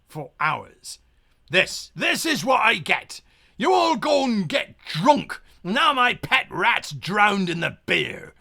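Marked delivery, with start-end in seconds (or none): 1.5-8.3 furious pirate captain bellowing at his crew; angry pirate yelling at drunken sailors; angry captain shout. Acted.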